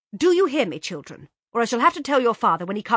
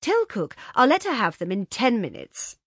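firm, higher mother's instruction; firmly; 6 semitones higher, 8 dB louder than usual. A narrator's reading. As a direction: give the order firmly. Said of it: "she said to Sadie firmly".

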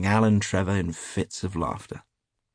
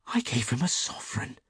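higher, hushed confession of horror; dismayed; 5 semitones higher than usual. A narrator's reading. Hughie confessing his gift to a millionaire, faintly dismayed. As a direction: admit it weakly, sinking in dismay. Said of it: "the picture of dismay".

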